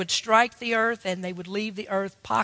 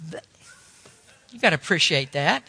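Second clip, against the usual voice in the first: ordinary talk, nothing marked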